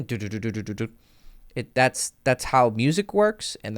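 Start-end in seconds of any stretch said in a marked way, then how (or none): 0.0-0.9 drop build-up hum. Retold or imitated. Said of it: "you're like".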